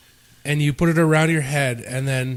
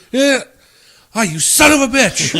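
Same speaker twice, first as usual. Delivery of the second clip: high, loud mock outrage; yelling and swearing; 10 semitones higher, 5 dB louder than usual. Pranked friend realizing what was done, yelling and swearing. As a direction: act out the pranked victim's outraged yell. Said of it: "he's, like, yelling and swearing at us".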